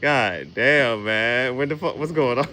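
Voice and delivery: ordinary talk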